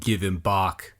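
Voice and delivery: a hint of a grizzled fighter; gruff Beogas. A narrator's reading. Warrior claiming his companion, lightly gruff. Beogas demands Luque back.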